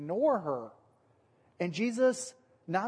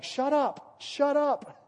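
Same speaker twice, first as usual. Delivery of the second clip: higher, louder mock shushing; hushing rebuke; 9 semitones higher, 4 dB louder, much slower than usual. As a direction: hush the crowd sharply. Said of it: "the disciples are saying".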